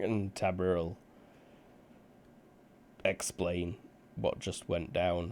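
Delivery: normal talking voice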